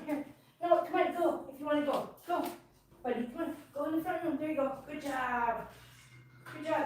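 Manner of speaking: softly coaxing the dog along; gentle, hushed calls to get the dog moving. Real, not acted. To the dog Kira, coaxing it into the front room, hushed and soft.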